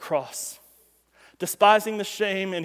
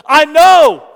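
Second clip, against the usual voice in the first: full shout up to his son; father yelling; 7 semitones higher, 17 dB louder than usual. Retold or imitated. Father yells from the bottom of the pit to his son. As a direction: yell it high and very loud. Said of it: "he yells at his son".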